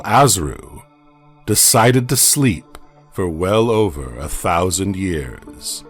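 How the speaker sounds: ordinary delivery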